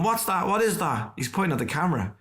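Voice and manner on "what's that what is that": put-on high, baffled pub drunk; Brendan Behan puzzled. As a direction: Brendan Behan in the Stag's Head, baffled by the camera. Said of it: "and he's going".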